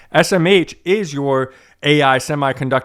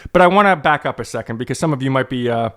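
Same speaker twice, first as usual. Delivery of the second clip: ordinary talk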